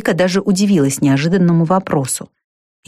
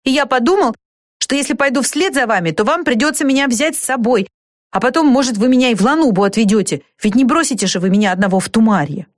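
put-on higher, pleading boy's voice; умоляющий мальчик; 6 semitones higher than usual. A narrator's reading. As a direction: talk them round like an anxious boy. Мальчик уговаривает взять его с собой. (упрашивающий мальчишеский голос, повыше)

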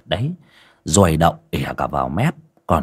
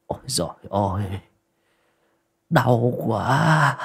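groaning in pain; 4 semitones higher, 3 dB quieter than usual. A narrator's reading. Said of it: "Lão rên rỉ".

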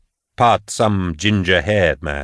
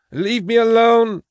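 much higher, cracking sob; sobbing plea. A narrator's reading. Patience sobbing at the voice, begging to be left. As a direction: cry it out, breaking. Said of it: "she sobbed".

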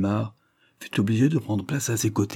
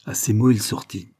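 ordinary delivery

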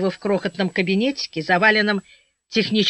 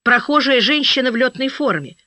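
usual speaking voice

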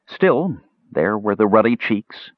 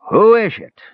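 high, loud tipsy dwarf; ale-dulled demand; 14 semitones higher, 6 dB louder than usual. A narrator's reading. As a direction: demand it loudly, dulled by drink. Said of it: "demanded a familiar voice that the half-elf suspected was dulled by ale".

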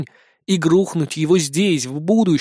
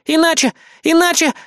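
whiny; 12 semitones higher, 4 dB louder than usual. A narrator's reading. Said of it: "закапризничала она".

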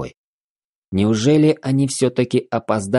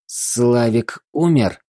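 slow, strained wheezy whisper; wheezing; 3 semitones higher, much slower than usual. A narrator's reading. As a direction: push it out through a tight throat. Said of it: "выдавливая из горла свистящие звуки".

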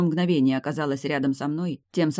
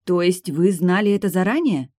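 loud, higher-pitched challenge; incredulous question; 5 semitones higher, 6 dB louder than usual. A narrator's reading. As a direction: demand confirmation in disbelief.

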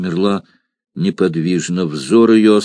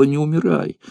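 whispered plea; 8 semitones higher than usual. Acted. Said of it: "и прошептала".

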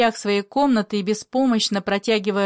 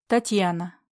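ordinary delivery

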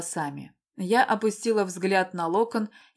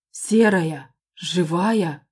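loud stunned exclamation; can't believe his eyes; disbelieving; 5 dB louder, much slower than usual. A narrator's reading. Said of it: "проговорил он неверяще".

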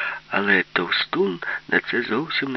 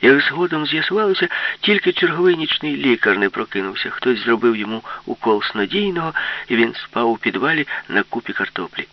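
high, loud, thrilled storytelling; excited narration; 9 semitones higher, 4 dB louder than usual. A narrator's reading. Narrator telling Yulka's war-cry attack, excited. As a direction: race through the battle cry scene with gusto.

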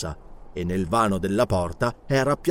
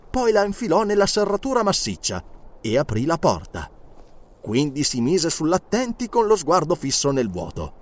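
usual speaking voice

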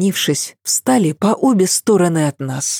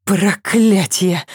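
exhaled curse; 3 semitones higher, slower than usual. A narrator's reading. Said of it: "выдохнула".

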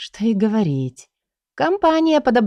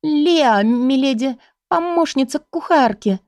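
high, trembling little voice; trembling hushed pleading; 6 semitones higher than usual. A narrator's reading. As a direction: plead with a shaking voice. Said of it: "дрожащим голосом сказала".

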